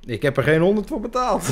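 high, loud laughing protest. Real, not acted. Laughing denial.

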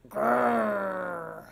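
playful growl like a bear. Acted. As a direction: growl it like a cub. Child growls like polar bear.

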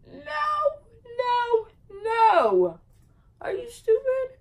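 loud, high-pitched no, no, no, then a shriek; outraged yell. Retold or imitated. Refusing him, she shouts at him in disbelief.

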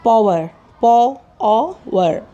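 पावर said, then split slowly into पॉ and वर; syllable by syllable. Shown as a demonstration. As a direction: break पावर into two beats with pauses. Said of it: "इसका pronunciation दो में break हो जाएगा". (पावर को दो हिस्सों में तोड़कर)

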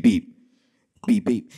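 low spoken beeps; imitated call-waiting beep. Call waiting cutting into weather line, imitated beep. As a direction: mimic a phone's beep. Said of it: "then you would hear the beep".

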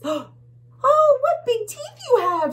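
very high, loud squeal; tiny bug. Acted. Tiny bug marvelling at the teeth, high and loud. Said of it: "says a tiny bug".

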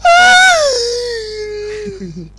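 high shrieking laughter. Real, not acted. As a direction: burst into squealing laughter. Laughing after jump.

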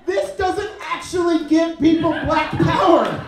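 loud, slow and emphatic; shouting. Real, not acted. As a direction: belt the punchline out slowly. Punchline shouted.

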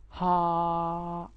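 ها held long and breathy with mouth open; long open H sound. Shown as a demonstration. Teacher showing the H sound, long ها. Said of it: "صوت عالي كده".

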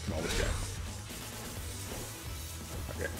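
usual speaking voice

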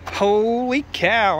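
long drawn-out, amazed exclamation; surprised exclamation. Real, not acted. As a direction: stretch it out slowly in astonishment. Reacts to a bad repair found.

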